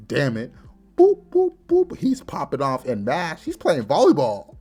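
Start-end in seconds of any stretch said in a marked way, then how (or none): 1.0-1.9 very high, loud repeated boops; cartoon bounce noises, high and loud; squeaky boops. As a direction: bounce each boop like a ball. Spells bounced like volleyball.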